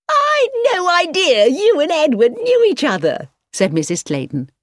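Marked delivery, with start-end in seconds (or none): 0.1-3.3 gushing hostess. A narrator's reading. Mrs Clayton's hostess voice.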